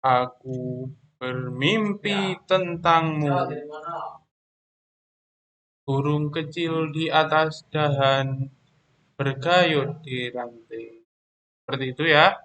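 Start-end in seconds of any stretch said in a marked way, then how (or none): 0.0-11.0 slow, measured poem reading with rises and falls; poem recitation demo. Shown as a demonstration. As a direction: read it like a recited poem, pausing between phrases. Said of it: "Contoh saya bacakan satu baris saja ya".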